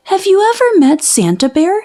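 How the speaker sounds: high, quick anxious question; nervous little cub. Acted. Little sister nervously asking Brother about Santa, high. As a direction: ask it fast and worried. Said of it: "she asked Brother".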